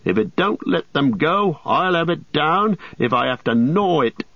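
fierce. A narrator's reading. Sam vowing to free his friends, fierce and determined. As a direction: vow it with stubborn fierceness. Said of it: "said Sam fiercely".

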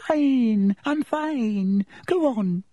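put-on very high, drawn-out girl's voice; high-pitched girl. Girl hurrying friends off from behind a door, high-pitched. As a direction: squeal it high and insistent. Hermione answers through a closed door.

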